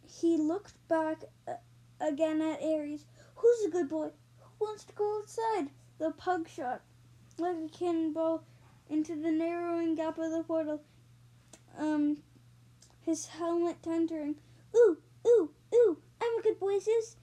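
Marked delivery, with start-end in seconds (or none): 3.4-5.7 higher-pitched talk to a pet; coaxing a dog. Acted. Zeus to his pug, asking about outside, playfully coaxing. 14.8-17.2 high, loud, eager pug yelps; excited shout. A narrator's reading. Pug answering Zeus about going outside, excited shout. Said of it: "he shouted".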